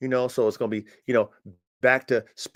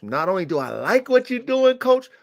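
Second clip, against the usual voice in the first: higher, rising mock praise; building buyer enthusiasm; 9 semitones higher than usual. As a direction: play the buyer getting carried away.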